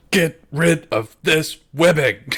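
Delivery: loud, high, drawn-out yell; angry yell. Acted. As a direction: yell it as a furious order, word by word. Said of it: "he yells at Khaldun".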